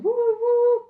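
long high boat-horn woo; drawn-out woo held on a high note; imitated boat horn. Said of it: "they can hear the boat, it's going".

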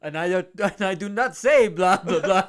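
put-on loud hammy vampire accent; Dracula voice. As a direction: ham up the Count. Hotel Transylvania quote.

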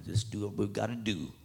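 quiet sly push to revenge; low menacing buddy. Retold or imitated. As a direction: hush it like a partner in crime. Hooded buddy urging revenge.

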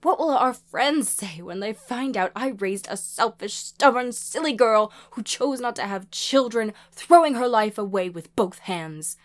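loud, high-pitched reproach turning to pleading; upset mother's loud tirade; distraught scolding. A narrator's reading. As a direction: berate your child, then beg.

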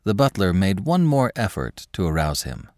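plain reading voice

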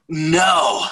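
high, loud cry of dismay at being stranded; upset cry. Retold or imitated. A stranded rider cries out to his wife on the phone, dismayed wail. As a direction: wail it like a crushed kid. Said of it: "I was so upset".

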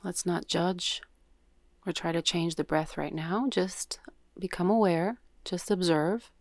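unhurried yoga nidra guidance with long pauses; guided meditation. Real, not acted. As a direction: guide them gently into breath awareness, leaving space after each cue.

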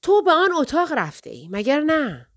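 high, loud accusing question; growling accusation. A narrator's reading. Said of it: "غرید".

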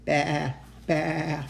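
drawn-out sheep baa; sheep bleating. A narrator's reading. Storyteller imitating George's flock on the hillside, sheep bleat.

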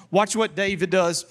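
normal talking voice

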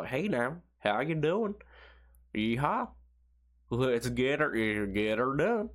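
hammed-up southern American yokel twang. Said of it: "They probably look at Americans are all".

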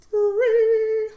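jumps way up, loud and squeaky; squeaky high. Pretend. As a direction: squeal the word in a comic high voice. Joking punchline on price.

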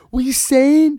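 high, loud mocking Manchester voice; mock Manchester accent. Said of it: "they're like".

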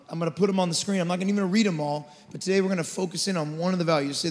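ordinary talk

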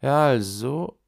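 awkward, drawn-out filler; embarrassed. A narrator's reading. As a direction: stall awkwardly, mildly embarrassed. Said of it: "sagt verlegen". (leicht verlegen)

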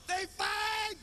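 high shouted alert; announcement shout. Retold or imitated. Daughter announcing the fight to everyone, shouting high. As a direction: yell it out like a street announcement. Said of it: "My daughter".

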